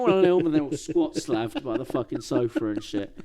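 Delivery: ordinary talk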